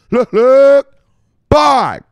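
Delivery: very high, very loud yelled buy; excited scream. Real, not acted. Vlogger screaming at his viewers, frantic yell. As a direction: scream it like a hype man.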